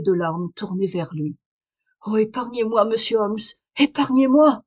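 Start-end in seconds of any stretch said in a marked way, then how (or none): 2.1-4.6 building desperate plea, louder on the repeat; pleading. A narrator's reading. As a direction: beg him for mercy. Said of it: "supplia-t-elle".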